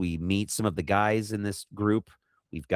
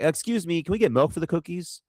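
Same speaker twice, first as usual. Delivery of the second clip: much higher-pitched mock asking voice; imitated polite interruption; 9 semitones higher than usual. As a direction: pipe up high, imitating someone butting in with a request. Acted interruption by a movie character.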